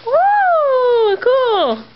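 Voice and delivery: high whooping delight; excited. Real, not acted. Thrilled at basket treat.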